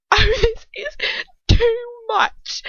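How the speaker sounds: high, loud, gasping amusement; breathless with laughter. A narrator's reading. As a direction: say it through helpless laughter. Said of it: "struggling to get her breath through her laughter".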